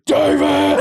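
high-pitched retold yell; mock scream. Imitating two hosts trying to halt the guest, mock scream. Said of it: "He's screaming".

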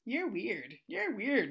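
put-on high, singled-out kid voice; little kid. Little kid comes up to the substitute, high mimicked kid voice. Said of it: "a little kid comes up to me".

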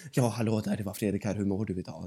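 a hushed whisper mocking the idea. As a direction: whisper it to show how silly it sounds. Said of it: "går runt och viskar".

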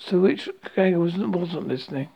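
usual speaking voice

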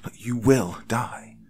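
slow, weighted "you will die"; "will" and "die" set apart for emphasis; warning. A narrator's reading. Narrator warning him against escape, "you will die" slow and grave. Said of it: "I warned".

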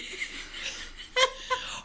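helpless wheezing laugh, high and airy; high breathless laughter. Real, not acted. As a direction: laugh until breath runs out. Hysterical laughter.

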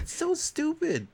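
high, slow, drawn-out whine; exasperated. Real, not acted. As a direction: whine it out slowly.

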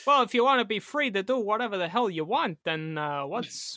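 exaggerated swooping, oddly paused actor voice; Christopher Walken. Challenging cohost to drive left, mock actor impression. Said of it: "only do a Christopher Walken accent".

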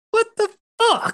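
high shrieking what the fuck; stunned when the boss dies in one hit; shocked disbelief. Retold or imitated. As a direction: squeal it in total disbelief. Shocked the boss died instantly.

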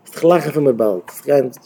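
ordinary talk, nothing marked

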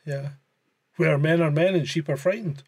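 ordinary talk, nothing marked

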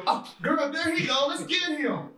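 high-pitched gossipy woman; gossipy girl voice. Retold or imitated. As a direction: lightly play a gossiping lady. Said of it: "Soon as he see them".

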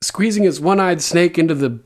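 half-acted Tyrion cracking up mid-quote; laughing retelling. Retelling Tyrion's mock confession at his trial, laughing. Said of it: "And he's like".

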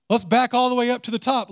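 usual speaking voice

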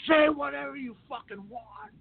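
high, slow, exasperated drawl; exasperated director. Retold or imitated. Fed-up director snapping at the new extra, slow high drawl. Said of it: "he looks at me and he goes".

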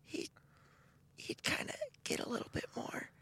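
soft whispered falsetto, fading; airy high voice. Shown as a demonstration. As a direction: imitate a voice that is mostly air. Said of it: "he would talk in a voice like this".

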